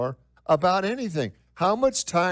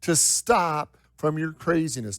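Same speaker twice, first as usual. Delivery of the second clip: normal talking voice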